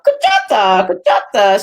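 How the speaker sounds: put-on loud French-accented name call; mock French accent, punched out loudly; heavy French accent. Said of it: "such a heavy French accent".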